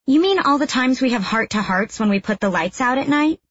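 high, mocking teenage sister; sarcastic kid sister. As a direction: needle her with fake sweetness. Little sister mocking narrator.

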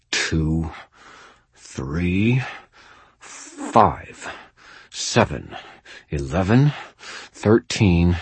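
counting with pauses through a tight jaw; muttered through clenched teeth. A narrator's reading. Terrified wizard counting primes to steady himself, muttered through clenched teeth. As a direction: force each number out through clenched teeth. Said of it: "I muttered through clenched teeth".

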